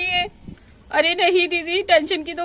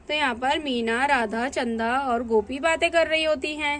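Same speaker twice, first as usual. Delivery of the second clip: lower, softer narrator voice; story narration; 4 semitones lower, 5 dB quieter than usual. A narrator's reading. Narrator tells the audience who is talking, in a soft storytelling voice. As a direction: set the scene quietly, storyteller style.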